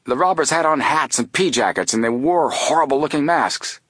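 high-pitched, agitated account; highly excited. A narrator's reading. Guard describing robbers to police, highly excited. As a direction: gush out an eyewitness report. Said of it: "highly excited".

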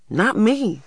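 put-on high-pitched little boy's protest; denying. A narrator's reading. Little boy disowns the shared blame, denying. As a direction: protest innocence in a child's voice. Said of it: "denied Christopher John".

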